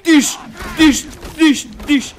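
high, loud squeaky stabbing sounds; dish dish stab noise. Pretend. Gamer voicing his knife stabs again, high and loud. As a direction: chant the stab noise like a cartoon. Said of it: "faut que j'arrête de dire di".

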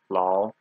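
Lao kept low in pitch; teacher's low third-tone demo of Lao. Shown as a demonstration. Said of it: "It's also a third tone".

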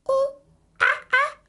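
very high, loud monkey cries; monkey noises. A narrator's reading. Monkey answers the police officer in high, loud cries. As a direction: answer only in monkey sounds.

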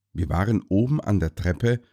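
ordinary delivery

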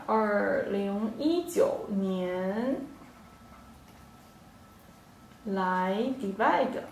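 slow teaching demo. Shown as a demonstration. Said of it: "teacher modelling the sentence".

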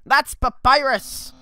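loud, proud skeleton dreaming of glory; Papyrus voice. Acted. Papyrus proclaims his dream to the human, loud and proud. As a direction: boom out the titles, slow and grand. Reads Papyrus dialogue aloud.

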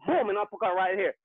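ordinary delivery

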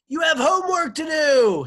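very high, very loud teacher's yell; stern teacher hollering at full voice; teacher's shouted demand. A narrator's reading. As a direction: shriek it down at a cornered pupil. Teacher yells down at former pupil.

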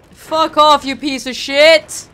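loud, drawn-out cursing; loud exasperated swearing; angry yell. Real, not acted. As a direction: shout it at the enemy, stretching the end. Swearing at enemy in game.

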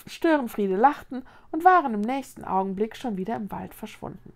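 ordinary delivery

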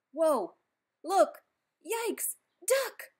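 high, loud mock alarm building to a yell; alarmed warning. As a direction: shout duck as if poo is flying. Monkey about to throw poo.

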